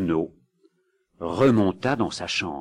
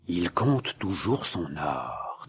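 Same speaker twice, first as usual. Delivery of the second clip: hushed fear; 3 semitones lower, 6 dB quieter, slower than usual. Acted. Terrified wife whispers report.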